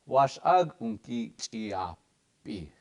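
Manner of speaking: "ag" said with an open ah, rising, then "unki, chiapi" trailing off low; Dakota word demo. Shown as a demonstration. Teacher models "ag" with ah sound for learners. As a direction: say "Wash, ag, unki, chiapi" with an ah vowel in "ag". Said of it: "So this eh turns to ah now".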